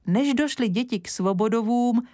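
ordinary delivery

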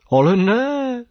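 high, delighted cry of surprise; surprised exclamation. Acted. Professor recognises the bat, surprised. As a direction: call out in happy shock.

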